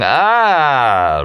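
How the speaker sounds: long, high held cry of laughter; great long "Ah". A narrator's reading. Remy's laugh resounding around the canyon, long exultant "Ah". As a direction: let a big "Ah" ring out, drawn out. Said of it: "that great long".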